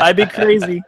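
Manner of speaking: laughing. Real, not acted. Laughing while joking.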